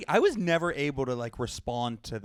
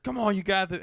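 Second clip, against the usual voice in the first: higher-pitched mock pep talk; pushy trainer; 7 semitones higher, 3 dB louder than usual. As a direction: imitate a trainer egging on a client. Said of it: "you gotta stop yelling at me".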